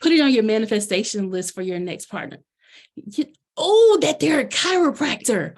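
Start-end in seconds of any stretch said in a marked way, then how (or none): 2.9-5.5 higher, louder, eager realization; light up at the idea; sudden excited realization; excited burst. Real, not acted. Suddenly excited by friend's suggestion.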